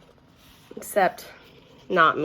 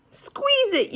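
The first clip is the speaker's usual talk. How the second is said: yelled. A narrator's reading. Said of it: "yelled Sheldon".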